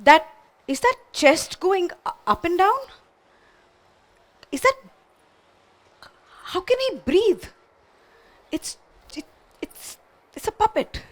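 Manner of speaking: high, halting, stammered disbelief; confused alarm. Acted. Ventriloquist sees his puppet breathing, confused alarm. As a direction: stammer with long gaps, pitch climbing. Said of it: "He's very confused".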